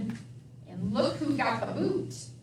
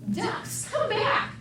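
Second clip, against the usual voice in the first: duck call; 10 semitones higher, slower than usual. Acted. Calling the ducks.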